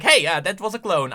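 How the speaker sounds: ordinary talk, nothing marked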